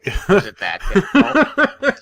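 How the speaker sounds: high, slow patronising coo; mock baby talk. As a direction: talk down to him sweetly. Teasing Malachi about the link.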